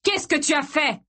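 high-pitched furious demand; enraged accusing cry; roar. A narrator's reading. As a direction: demand it in outrage. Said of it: "rugit-il".

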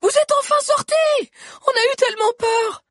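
put-on high, loud girlish relief; relieved cries. A narrator's reading. The Lolly Girls greet the fugitives, high and loud with relief. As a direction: gush with relief.

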